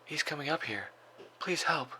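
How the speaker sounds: put-on high, frightened little girl's plea; hushed sobs. A narrator's reading. Hiding girl begs the 911 operator for help, hushed sobbing. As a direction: plead like a scared child in hiding. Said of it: "Elizabeth's voice came out in hushed sobs".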